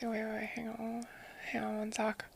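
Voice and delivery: soft ASMR whisper close to the mic; ear whispering. Real, not acted. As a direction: breathe it right into their ear. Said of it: "somebody else redeemed whispering".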